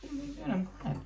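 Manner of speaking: lilting, high and cheerful; high sweet parent voice. Real, not acted. Asks child about story.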